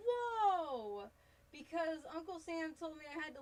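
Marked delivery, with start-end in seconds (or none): 0.0-1.1 gasping whoa. Real, not acted. Reacting to chat's weight loss.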